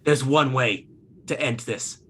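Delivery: low, slow dramatic challenge; the Don. Acted. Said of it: "He goes".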